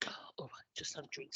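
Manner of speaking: hushed aside to self; barely voiced, under the breath; whispering. Real, not acted. Aside after coughing, taking drink.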